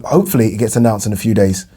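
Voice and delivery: normal talking voice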